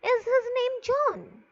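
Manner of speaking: high-pitched yes-no question lifted toward "John"; rising intonation. Shown as a demonstration.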